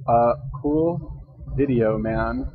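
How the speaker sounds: slow, drawn out as if typing each word; slow dictating. Real, not acted. Writing a comment to the YouTuber, slow dictation. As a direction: spell it out slowly while writing. What am I gonna say on here?.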